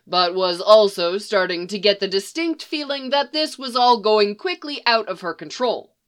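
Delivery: ordinary delivery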